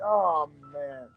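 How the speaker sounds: usual speaking voice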